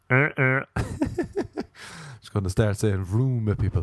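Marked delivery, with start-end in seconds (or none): 0.1-0.7 short two-note honk; vocal car-horn imitation; car horn noise. Shown as a demonstration. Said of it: "he sounds like a car".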